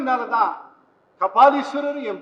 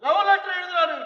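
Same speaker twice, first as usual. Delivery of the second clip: ordinary talk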